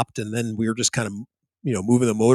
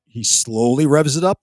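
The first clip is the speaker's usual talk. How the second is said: ordinary talk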